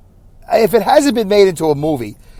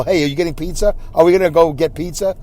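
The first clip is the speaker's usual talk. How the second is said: ordinary talk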